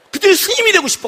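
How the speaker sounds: high, loud, half-laughing grumble; exasperated venting. Retold or imitated. Pastor confiding his frustration to his wife, exasperated.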